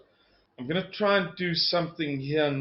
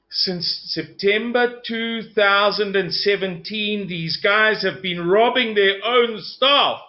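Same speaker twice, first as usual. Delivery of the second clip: slow, raised, emphatic outrage; real indignation; 6 semitones higher, 4 dB louder, slower than usual. Real, not acted. Speaker telling viewers of robbed pension contributions, indignant. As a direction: drive each word home, high, loud and indignant.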